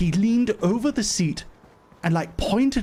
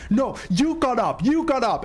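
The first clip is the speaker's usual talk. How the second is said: high, repetitive mock accusing; insistent accusation; 7 semitones higher than usual. Man points his finger in her face, accusing. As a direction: hammer the accusation in a raised pitch.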